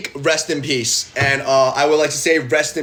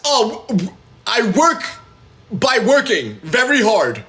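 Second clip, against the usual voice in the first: evasive stammer; 8 semitones higher, slower than usual. Retold or imitated. Evasive answer, acted.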